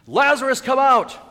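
very loud, high-pitched cry; thundering pulpit command; commanding shout. Acted. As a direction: summon the dead with full force. Preacher proclaims Christ's command.

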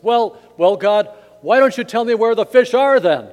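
loud, high exasperated outburst. Acted. Ice fisher questions God, loudly exasperated. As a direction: complain up to heaven, fed up. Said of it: "he's exasperated".

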